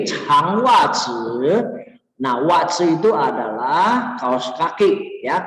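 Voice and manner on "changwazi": "Changwazi" very slowly, ch with a clear puff; aspirated CH. Shown as a demonstration. Teacher modelling the C spelling, drawn-out aspirated "Changwazi". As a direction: stretch out "Changwazi", breath bursting after the ch. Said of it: "pakai C".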